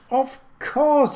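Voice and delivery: sudden high, loud burst of realization; light up and blurt it out; exclaiming. A narrator's reading. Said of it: "he exclaimed".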